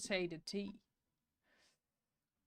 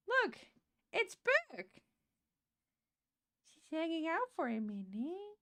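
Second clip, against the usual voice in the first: high, sweet greeting voice; pet talk; 9 semitones higher than usual. Real, not acted. Streamer shows the dog to viewers, high and sweet. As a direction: show off the pet to chat.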